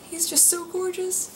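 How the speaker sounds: fighting back tears while gushing about her newborn son; teary, close to crying. Real, not acted. New mother choking up recalling her son's birth, teary. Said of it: "I'm gonna cry thinking about it".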